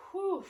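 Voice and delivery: loud whew. Real, not acted. Exhaling hard after a set.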